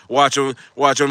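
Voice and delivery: normal talking voice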